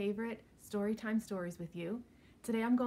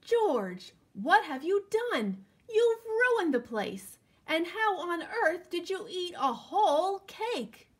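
higher, louder dismayed scolding of the dog; 6 semitones higher, 6 dB louder, slower than usual. Acted. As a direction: scold the dog in shocked disbelief. Owner scolds dog for mess.

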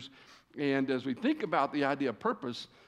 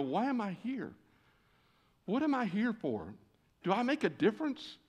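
higher, softer, wondering questions to yourself; doubtful inner voice; 6 semitones higher, 4 dB quieter than usual. Retold or imitated. Preacher voicing an audience member's doubts, soft and higher. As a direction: voice the listener's quiet self-doubt. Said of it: "Sometimes we go".

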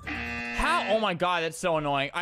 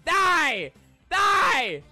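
furious shouting; raging; 4 semitones higher, 6 dB louder, much slower than usual. Real, not acted. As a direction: yell at the traitors in fury. Voted out, raging.

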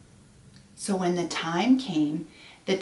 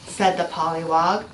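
ordinary delivery, nothing marked

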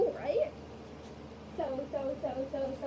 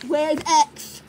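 "Where's X?" said louder with X punched; impatient louder repeat; 7 dB louder than usual. Real, not acted. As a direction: call it out louder, stressing X. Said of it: "I said".

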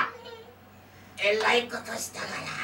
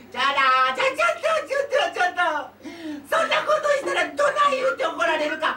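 panicked fox cub; 10 semitones higher, faster than usual. Acted. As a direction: stop him before he leaves, in a fox cub's high voice. Fox cub calls after man. (子狐が慌てて甲高く呼び止める)

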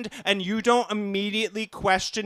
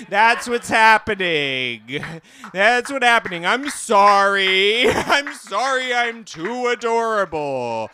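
mock-pleading protest; 2 semitones higher, 5 dB louder than usual. Comic pretending to be arrested for cuteness, mock-pleading protest. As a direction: cry it out in hammed-up apology.

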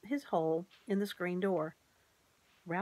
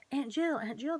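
high, eager calling out; excited calling; 7 semitones higher than usual. Acted. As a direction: call her urgently, all in a rush. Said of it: "they cried".

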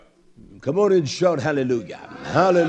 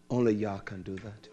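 "only Yah can do that" dropped lower and much softer; hushed; 9 semitones lower, 12 dB quieter than usual. Acted. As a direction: say it low, almost to himself. Repeated line, second take.

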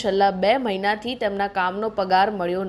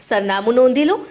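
punchy sales pitch with lifted, swinging intonation; ad read. Acted. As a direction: sell it like a local shop commercial, pushing the key names. Reads a mobile shop advert. (જાહેરાતની જોશભરી શૈલી)

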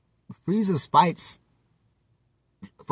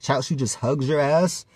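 usual speaking voice

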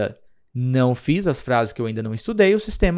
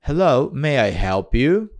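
repetição lenta; slower than usual. Shown as a demonstration. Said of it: "olha só".

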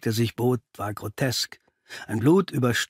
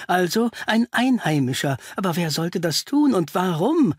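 higher-pitched, unsettled doctor; flustered Doctor Pickley; 6 semitones higher than usual. Acted. Pickley puzzles over the case to Holmes, flustered. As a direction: voice a worried visitor thinking aloud, pitched up.

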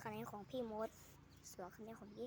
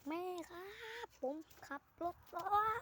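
high, raised call across the house; calling out to mom; 8 semitones higher than usual. Pretend. Calling to mom after crash.

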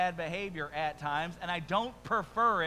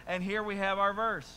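ordinary delivery

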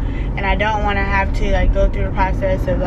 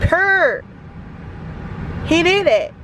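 gushing approval; 8 semitones higher, slower than usual. Real, not acted. Vlogger praising the nail tech to viewers, gushing. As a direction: hype up the work, pitched high.

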